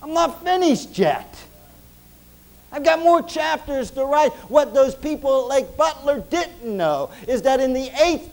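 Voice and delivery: high, loud, driving preacher voice; full-force preaching. Acted. Preacher to his church, high and loud full-force preaching. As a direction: preach it hard to the congregation.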